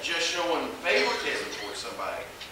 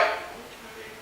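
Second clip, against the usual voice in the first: meek okay; 9 dB quieter than usual. Acted. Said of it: "It is kinda like".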